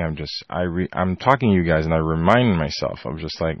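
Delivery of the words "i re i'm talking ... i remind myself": ordinary talk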